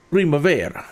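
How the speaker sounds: hammed-up Italian accent, rolling the r in Primavera. Shown as a demonstration. Pilot hyping his pasta dinner to viewers, in a hammed-up Italian accent. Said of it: "with the best Italian accent you can muster".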